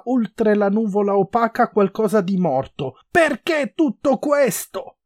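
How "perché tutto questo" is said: high, loud, drawn-out desperate question; shouted desperate plea; desperate cry. A narrator's reading. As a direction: scream it out slowly so as not to go mad. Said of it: "si sgolava per non impazzire".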